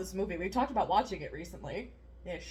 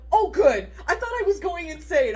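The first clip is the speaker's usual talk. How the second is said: higher, louder relieved voice; relieved client; 9 semitones higher, 8 dB louder than usual. Retold or imitated. Yoga client telling the instructor she feared going insane, relieved and loud. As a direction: sigh of relief, half laughing.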